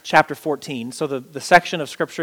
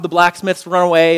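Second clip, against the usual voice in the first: normal talking voice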